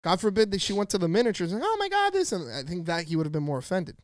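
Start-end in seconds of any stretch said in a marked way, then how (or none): 1.6-2.3 very high imitated squeal; girl's exclamation. Girl imagined at the miniatures, high mock exclamation. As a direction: jump way up in pitch as the girl.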